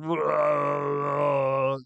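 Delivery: a dopey giant's bellow; mock giant whoa; giant Grawp. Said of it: "And Groppy's going".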